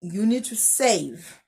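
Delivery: ordinary talk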